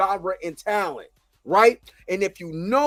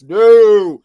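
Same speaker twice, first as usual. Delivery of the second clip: sudden loud no; emphatic no; 12 dB louder than usual. Real, not acted. Ranting to viewers about Tyler Perry, shouting no.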